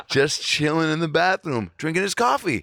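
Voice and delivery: high, laughing through the words; real laughter lifting the voice high; amused laughter. Real, not acted. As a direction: crack up while saying it, pitch climbing. Cracking up at his own story.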